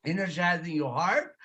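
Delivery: ordinary talk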